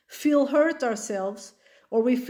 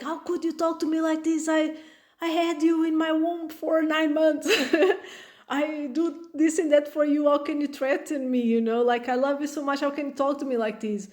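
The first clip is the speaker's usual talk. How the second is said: higher, hurt guilt-tripping plea; wounded parent; 4 semitones higher than usual. Retold or imitated. Mother hurt by her threatening child, mock-wounded plea. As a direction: play the injured mother, lightly.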